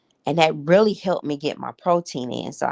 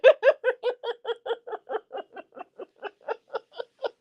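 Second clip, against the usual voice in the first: a long, high-pitched laugh; high giggle. Real, not acted. Laughing at her own advice to viewers, high giggle. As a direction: let a bright cackle run out.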